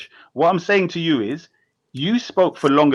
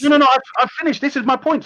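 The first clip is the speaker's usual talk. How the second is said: heated protest; 8 semitones higher, faster than usual. Real, not acted. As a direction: jump back in, pitched up and forceful. Heated debate, cutting back in.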